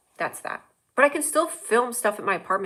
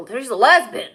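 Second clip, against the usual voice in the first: grandpa impression; 3 semitones higher, 8 dB louder than usual. Grandpa spotting one, loud mock exclamation of LEZ-bin. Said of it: "My grandpa called lesbians lesbians".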